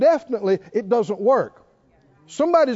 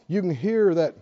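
usual speaking voice

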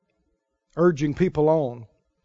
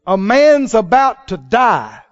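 loud, slow preaching; building slow preach; slow emphatic warning; 5 semitones higher, 10 dB louder, slower than usual. Real, not acted. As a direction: hammer home the danger. Preacher driving the point.

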